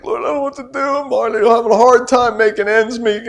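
high, strained pleading to the Lord; desperate plea. Retold or imitated. Preacher retelling his job worries to God, high and pleading. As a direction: cry out to God from the end of your rope. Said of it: "And I was like".

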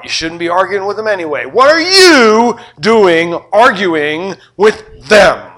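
loud, slow scolding of the disciples; hammered-up stern rebuke; scolding teacher. Retold or imitated. Jesus scolding his disciples for arguing, loud and slow.

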